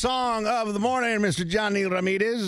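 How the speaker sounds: slow, high announcer intro; radio announcer. Real, not acted. DJ announcing first song.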